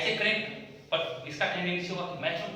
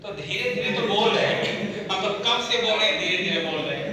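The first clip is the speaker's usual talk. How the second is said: indignant protest; 5 semitones higher, 7 dB louder than usual. Real, not acted. Protests he is speaking slowly.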